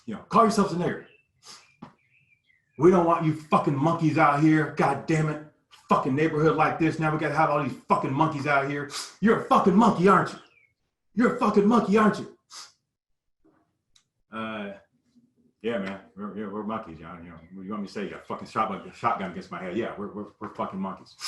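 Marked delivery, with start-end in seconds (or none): 0.3-12.4 going off on us. Retold or imitated. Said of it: "he's just going off on us". 14.3-21.2 cowed mumbling reply. Retold or imitated. Frightened boy's cowed reply.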